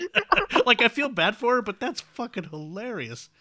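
high-pitched laughing speech; giggling through the words. Real, not acted. Laughing at turtle story.

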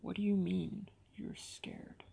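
slow, breathy church whisper; slow anxious whisper between two scared people. Retold or imitated. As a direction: whisper so no one in the pew hears. Hushed exchange in church pew.

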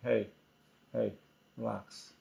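dropped low soft calming voice; demonstrating the calm tone for dog owners, hushed. Shown as a demonstration. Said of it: "the tone of my, like".

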